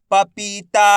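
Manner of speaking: पपीता sounded out with long vowels; drawn-out long ई. Shown as a demonstration. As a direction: stretch पी and ता in पपीता. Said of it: "खींच के पढ़ा जाता है".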